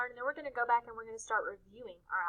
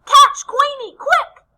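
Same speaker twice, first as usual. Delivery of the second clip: high, shouted alarm; urgent storybook shout, pitched way up; loud quacking; 19 dB louder, slower than usual. A narrator's reading. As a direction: yell the order as a noisy duck. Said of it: "Dottie's loud quacking".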